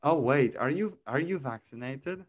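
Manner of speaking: ordinary delivery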